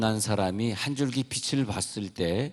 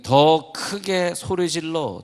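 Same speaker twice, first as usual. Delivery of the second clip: retold louder cry; 6 semitones higher, 4 dB louder, slower than usual. Retelling the blind man shouting louder.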